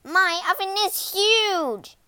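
high, loud, slow witch shriek; very annoyed witch. A narrator's reading. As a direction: stretch it out in loud exasperation. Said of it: "the witch was very annoyed".

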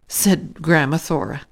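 ordinary delivery

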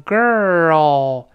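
put-on "girl" slow, curled r gliding into an oh-like l; r plus dark l. Shown as a demonstration. Teacher putting the sounds together, "girl" with r then oh-like l. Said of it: "再加L的声音读成".

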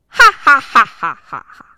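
booming hearty laugh; villain laugh. Acted. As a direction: laugh big like a proud tiger. (得意的大笑)

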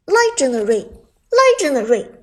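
loud repeated announcer call; game announcer. Acted. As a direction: hype kill announcer voice.

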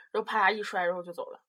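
ordinary talk, nothing marked

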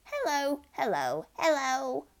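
high, stretched parrot greeting. A narrator's reading. Parrot showing off its talking, high parrot voice. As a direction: call out hellos like a parrot. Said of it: "the parrot said".

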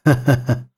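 low, self-satisfied laugh; smug chuckle. Acted. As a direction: chuckle knowingly. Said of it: "得意地笑道". (得意地低笑)

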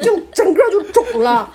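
high, loud, laughing. Real, not acted. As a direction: crack up at the pufferfish. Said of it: "气成河豚". (边笑边高声说)

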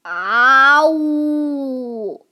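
long drawn-out roar "啊呜"; dinosaur roar. Acted. T-rex showing the baby how to roar, long and drawn. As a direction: roar like a giant beast.

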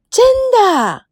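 high, drawn-out "really?" in amazement; amazed disbelief. Acted. Girlfriend hears of the kiss, amazed high squeal. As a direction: squeal it in astonishment. Friend reacts to kiss confession.

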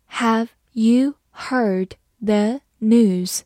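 very slow, each word spaced; slow reading. Shown as a demonstration. Teacher slowly reading the question to the class. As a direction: read it slowly for learners. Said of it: "好，慢读一遍".

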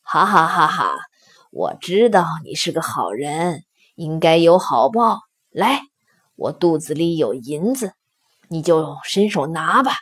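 low stone-lion voice with a spoken ha-ha; hearty laughing lion. A narrator's reading. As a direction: chuckle in words, then talk low and kindly. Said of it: "石狮子大笑说".

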